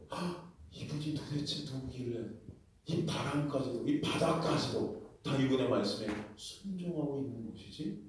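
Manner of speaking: soft, hushed astonished question; awed wonder. Acted. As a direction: marvel under your breath. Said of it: "와, 의아해합니다". (숨죽여 놀라며 묻는 목소리)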